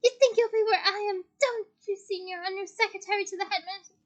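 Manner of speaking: high, loud, frenzied tirade; shrieking accusation. A narrator's reading. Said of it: "shrieked Professor Umbridge".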